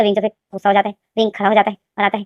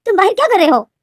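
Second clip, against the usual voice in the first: surprised mother; 9 semitones higher, 4 dB louder than usual. Acted. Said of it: "लेनदेन की माँ जो बोलती".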